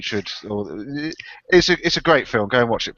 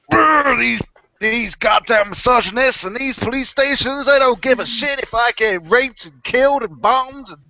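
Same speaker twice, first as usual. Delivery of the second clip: put-on high, drawn-out country accent rant; 8 semitones higher, 4 dB louder, slower than usual. Ranting about police stations as a victim, hammed-up country accent. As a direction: put on a loud, indignant country drawl. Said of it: "damn good country accent".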